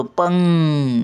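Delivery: "पंग," very slow, humming on "ng"; अंग असा उच्चार. Shown as a demonstration. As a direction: hold the nasal end of "पंग," long. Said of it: "असा उच्चार येतो". ("पंग," ङ लांबवून धरा)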